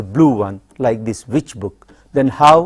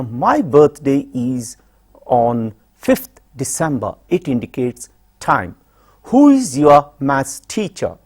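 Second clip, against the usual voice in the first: ordinary talk, nothing marked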